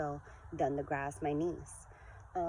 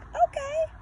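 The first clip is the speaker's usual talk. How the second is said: excited; 5 dB louder than usual. Retold or imitated. Her reply to daughter.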